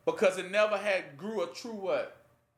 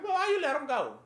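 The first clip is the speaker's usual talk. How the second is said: higher, complaining wolf voice; grumbling wolves, pitched up; upset wolves; 8 semitones higher than usual. Acted. Wolves protesting the freed bird, higher and aggrieved.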